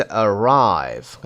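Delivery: arrive with RIVE punched; light start, strong second syllable in arrive; stress on second syllable. Shown as a demonstration. Teacher repeating the example for learners, second-syllable stress on arrive. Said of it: "قسمت دوم".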